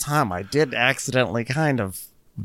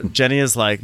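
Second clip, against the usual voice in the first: ordinary talk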